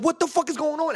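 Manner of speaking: high-pitched frantic question; mock alarmed outcry; acted panic. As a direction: reenact bewilderment in a sudden flood. Acting out panic at the flood.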